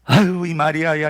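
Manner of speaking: loud exasperated sigh into a name; hammed-up weary reproach; mock exasperated call. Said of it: "이렇게 불렀을까?".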